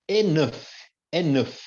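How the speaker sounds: "Enough" ends in F, "enéf". Shown as a demonstration. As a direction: stress the final F of "Enough". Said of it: "quando ele tem som de F". ("Enough" com som de F no final)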